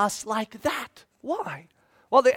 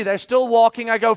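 ordinary talk

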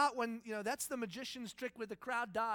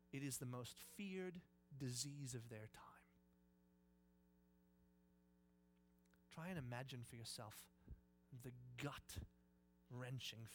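low, very soft, grave storytelling; near-whispered gravity with long pauses; hushed dread; 8 semitones lower, 12 dB quieter than usual. Real, not acted. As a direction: let the fear sink in, quietly. Hushed telling of leprosy fear.

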